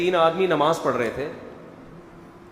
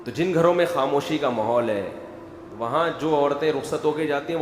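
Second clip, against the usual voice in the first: normal talking voice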